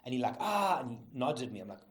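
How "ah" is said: high, loud mock howl; imitated cry of pain. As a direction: cry out as if mimicking his agony. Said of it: "from his pain or whatever".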